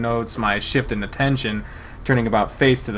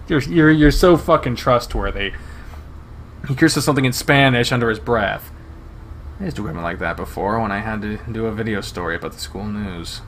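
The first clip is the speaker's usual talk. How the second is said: plain reading voice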